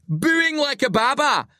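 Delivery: high, drawn-out jeer; gleeful howl. A narrator's reading. Locke jeers at Billy for crying, howling gleefully. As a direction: jeer slowly in delight, pitched high. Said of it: "Locke howls with delight".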